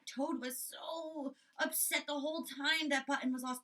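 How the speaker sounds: normal talking voice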